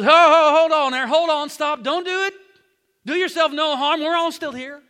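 Paul shouting to the jailer not to harm himself, urgent; urgent call to stop. Acted. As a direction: call out to stop him in time. Paul stops jailer's suicide.

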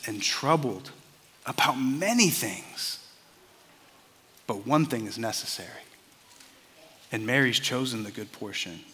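quiet, loving admonition; gentle rebuke. Retold or imitated. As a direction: correct her tenderly, unhurried at the end. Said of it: "a very gentle rebuke".

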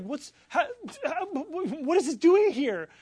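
high, stammering retold astonishment, bursting loud; stunned disbelief. Man finding his lost work in trash, incredulous. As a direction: stumble over the words in disbelief. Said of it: "I could not believe it".

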